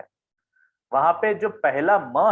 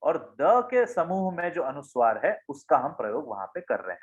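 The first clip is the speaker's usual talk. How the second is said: normal talking voice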